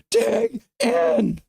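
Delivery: high, loud yelled cheer; loud shout. Pretend.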